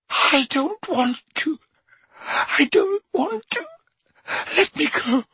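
very high begging voice; frightened pleading. A narrator's reading. Dumbledore begging Harry to stop, high fearful plea. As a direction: beg to be let go. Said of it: "frightened like this".

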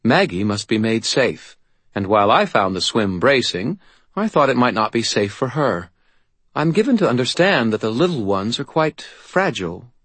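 a hint of an imperious faerie; faerie godmother.

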